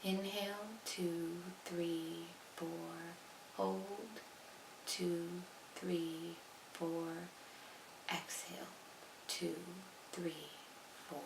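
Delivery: soft, measured meditation voice; guided meditation. Acted. Leading listeners through even count breathing, soft and calm. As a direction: count the breath quietly, leaving calm space between words.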